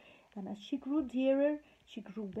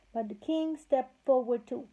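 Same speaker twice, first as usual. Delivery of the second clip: plain reading voice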